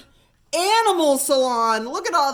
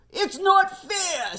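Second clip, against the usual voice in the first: slow, higher protesting shout; angry complaint; 7 semitones higher, slower than usual. Acted. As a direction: stamp your foot over it. Angry rival shearer complains.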